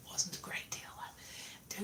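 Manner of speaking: hushed, breathy aside; a whispered confession. Real, not acted. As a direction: drop to a whisper for the joke. Aside about small pay.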